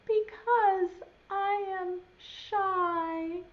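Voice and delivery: shy, slow little voice, high and hesitant; trembling voice. Acted. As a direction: speak as a timid creature hiding. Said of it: "a trembling voice says".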